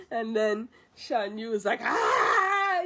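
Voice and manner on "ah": high, loud mock battle cry; short acted yell, pitched way up; imitated attack scream. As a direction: cry out like a villain lunging with a sword.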